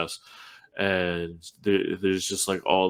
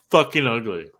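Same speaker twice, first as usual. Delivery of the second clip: ordinary delivery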